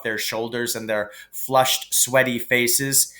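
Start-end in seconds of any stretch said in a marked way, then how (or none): none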